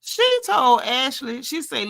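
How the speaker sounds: ordinary talk, nothing marked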